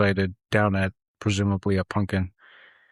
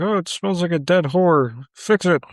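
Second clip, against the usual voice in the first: high-pitched mock griping; neighbor complaining loudly; 9 semitones higher, 4 dB louder than usual. Neighbor to Wilkins about the septic tank, complaining loudly. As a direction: imitate a neighbor hollering a complaint.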